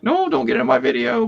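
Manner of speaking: mock high scold at the babies; lightly hammed-up shoo over baby noise; dad telling the twins to stay out of the video, mock high scold. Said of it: "I'm trying to speak over 'em".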